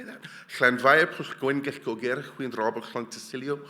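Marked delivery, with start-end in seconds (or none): none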